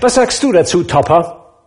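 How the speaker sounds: high, loud, teasing question to a friend; cheerful host ribbing his guest; teasing nephew calls to Topper; jovial nephew. Acted.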